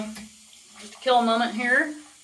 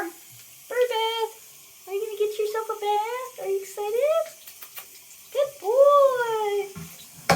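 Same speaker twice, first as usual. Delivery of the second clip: high pet talk; 11 semitones higher, much slower than usual. Real, not acted. Cooing to her parrot.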